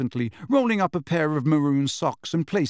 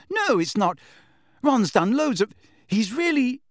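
high, flustered backpedalling; 10 semitones higher than usual. A narrator's reading. Hermione embarrassed, defending Ron, flustered. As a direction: scramble to take it back, pitched up. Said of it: "blushing harder than ever".

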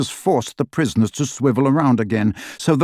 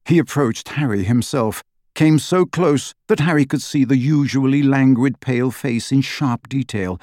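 ordinary delivery

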